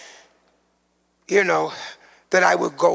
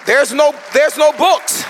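high, loud, slow shout from the pulpit; preaching shout; 8 semitones higher, 6 dB louder than usual. Real, not acted. Preacher shouts to the congregation, high, loud and slow. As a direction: thunder it out slowly, raised high.

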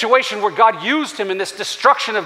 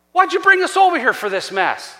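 higher-pitched, put-out complaint; higher-voiced protest; mock complaint; 7 semitones higher, 3 dB louder than usual. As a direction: act out an aggrieved grumbler blaming someone. Mocks Joshua blaming God.

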